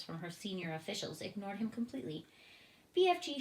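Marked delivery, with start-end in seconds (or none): 2.9-3.4 high, loud call to the BFG; queen summoning the giant; bright high call. A narrator's reading.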